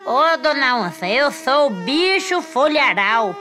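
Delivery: slow, sweet-talking disguised monkey; sly monkey voice. Acted. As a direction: coax her, drawn out and sly. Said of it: "E ele disse".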